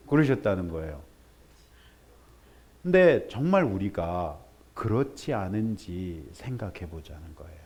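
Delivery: ordinary delivery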